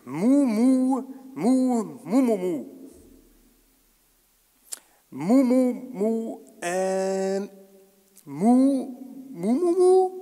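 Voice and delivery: loud mooing in a put-on bull voice; a hammed-up cow lowing. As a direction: try to talk to the Minotaur by mooing. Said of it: "meine Aussprache war nicht ganz korrekt".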